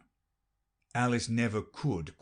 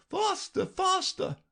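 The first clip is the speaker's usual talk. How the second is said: queen crying out. A narrator's reading. Queen urges Alice while running, high mild cry. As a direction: hurry her along in a high queen voice. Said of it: "cried the queen".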